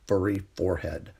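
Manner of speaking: usual speaking voice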